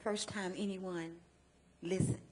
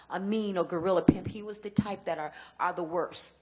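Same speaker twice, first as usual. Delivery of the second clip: ordinary talk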